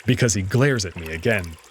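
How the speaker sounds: ordinary delivery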